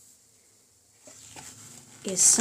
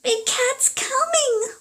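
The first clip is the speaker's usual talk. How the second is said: much higher, louder, slow frightened wail; 6 dB louder than usual. Acted. Henry spotting Big Cat's tail, slow terrified wail. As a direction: cry out in terror as the cat comes. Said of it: "wailed Henry".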